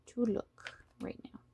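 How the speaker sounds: soft conspiratorial whisper. Real, not acted. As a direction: breathe it like a secret. Sudden whisper mid-sentence.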